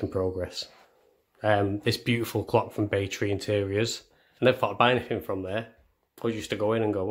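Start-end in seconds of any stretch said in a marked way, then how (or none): none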